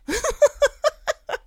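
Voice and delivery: short, high laugh; a quick high laugh. Real, not acted. Laughing after joke.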